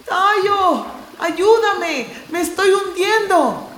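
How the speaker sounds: high, loud desperate shouts; calling for help. Acted. Core shouting to Tayo for help, high and loud. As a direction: yell across the river for help.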